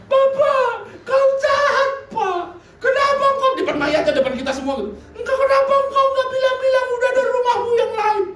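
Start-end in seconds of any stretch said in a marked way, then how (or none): 0.1-3.5 high, sing-song mock wail of grief. Pastor imitating a grieving aunt at the coffin, high hammed-up wail. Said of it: "lihat om saya nangis begini". 5.1-8.2 high, sing-song mock wail of grief. Pastor imitating a grieving aunt at the coffin, high hammed-up wail. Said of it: "nangis begini".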